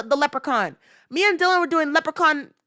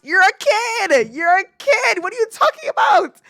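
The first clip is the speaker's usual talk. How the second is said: high-pitched, stretched scolding rising to a shout; exasperated shout; 9 semitones higher, 4 dB louder than usual. Real, not acted. Viewer scolding a former child star, exasperated shouting. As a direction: yell it up high in disbelief.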